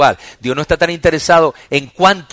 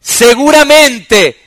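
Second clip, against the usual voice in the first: loud, high preaching, every phrase driven hard; full-force preaching; 10 semitones higher, 12 dB louder than usual. Real, not acted. Pastor preaching to the congregation, loud and high. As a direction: preach it out to the whole church.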